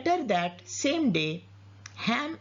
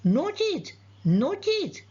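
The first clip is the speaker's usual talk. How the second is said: repeated yelling; 9 semitones higher than usual. A narrator's reading. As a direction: call it out twice, pitch leaping up then falling. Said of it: "He continued yelling".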